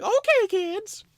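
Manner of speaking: squeaky gleeful dad offering a treat; giddy dad. Retold or imitated. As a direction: play the overexcited dad. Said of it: "my dad was like".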